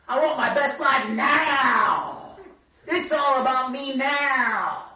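high, loud, drawn-out demanding shouts; clamoring crowd. Acted. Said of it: "They're saying".

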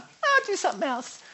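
light mock of a fickle churchgoer; mock flaky believer. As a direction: shrug it off like a quitter. Mocking a casual believer.

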